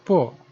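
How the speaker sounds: "po" cut off with a closed throat; final "po" with a glottal stop; throat closed at end. Shown as a demonstration. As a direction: end "po" by shutting the throat on the vowel. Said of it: "the throat is closed at the end".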